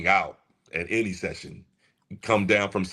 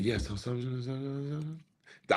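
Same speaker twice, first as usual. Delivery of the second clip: much softer, hesitant repeated so; talking soft; 2 semitones higher, 8 dB quieter than usual. Retold or imitated. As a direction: mumble it softly to calm her. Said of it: "I was just talking soft".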